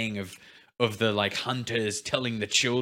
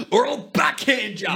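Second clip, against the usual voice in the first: laughing mock threat; 9 semitones higher, 4 dB louder than usual. Continuing parent quote, laughing.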